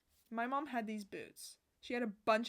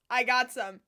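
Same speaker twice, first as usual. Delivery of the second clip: usual speaking voice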